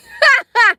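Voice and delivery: short loud high laugh. Real, not acted. Laughing at her son being protective, high laugh. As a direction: burst out laughing.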